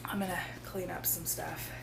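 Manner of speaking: hushed aside; soft unvoiced whisper. Real, not acted. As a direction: whisper it to the camera. Whispered aside while child near.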